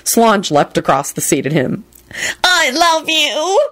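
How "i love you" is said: high, slow wailing cry; bawling. Acted. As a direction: screech it through sobs. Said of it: "she screeched and began bawling".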